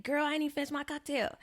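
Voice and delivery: high-pitched mock complaint; imitated homeboy protest. Homeboy told it's time to go, high imitated protest. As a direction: object as the friend, pitched well up.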